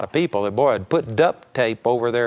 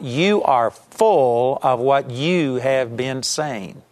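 slow emphatic; much slower than usual. Acted. Repeated key line for emphasis.

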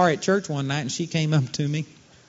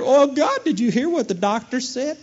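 higher, whiny complaint; higher, hammed-up whine to God; mock whining; 6 semitones higher than usual. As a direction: whine like someone hiding behind the diagnosis.

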